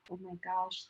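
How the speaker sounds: very soft, retold murmur; holding back tears. Recalling herself beside a sobbing mother, hushed and held back. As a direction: say it small, as if keeping from crying. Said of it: "I'm trying not to cry".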